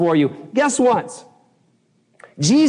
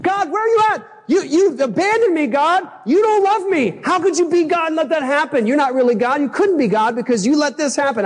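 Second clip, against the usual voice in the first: much higher, hammed-up wail at God; mock whiny complaint; 11 semitones higher than usual. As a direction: play your past self whining that God abandoned you. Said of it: "I'm like".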